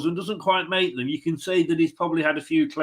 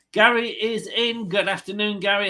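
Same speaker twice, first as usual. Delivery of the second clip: usual speaking voice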